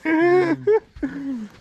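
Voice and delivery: high nervous laugh of relief; relieved laugh. Real, not acted. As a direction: laugh off the scare. Relieved after a fright.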